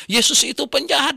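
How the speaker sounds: high, loud indignant cry; offended man retorting angrily; angry retort. Retold or imitated. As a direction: fling the insult back in anger.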